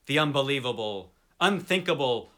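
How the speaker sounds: plain reading voice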